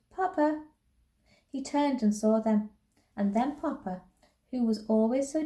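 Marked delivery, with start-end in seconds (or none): 0.1-0.6 put-on high, contained child's call; mild shout. A narrator's reading. Girl calling father across the crowd, mild shout. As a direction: call him over, pitched up. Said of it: "she shouted".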